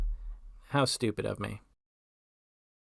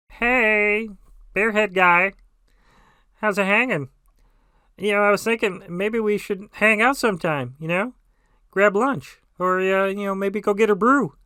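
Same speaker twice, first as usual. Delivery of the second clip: high, loud, awkward friendly yell; shouting over music; 8 semitones higher, 8 dB louder than usual. Acted. Would-be buddy to cyclist in earbuds, shouting over music. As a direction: holler a casual invite over someone's earbuds. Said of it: "trying to shout over Marcus Mumford".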